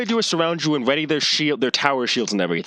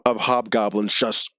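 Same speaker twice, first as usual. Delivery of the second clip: ordinary talk, nothing marked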